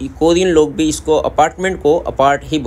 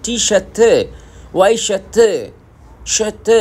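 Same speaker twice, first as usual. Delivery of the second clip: shat said higher, the r dropped; Korean accent; 6 semitones higher, slower than usual. Shown as a demonstration. Teacher drilling Korean shirt words, Korean accent. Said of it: "इस तरीके से बोलते हैं".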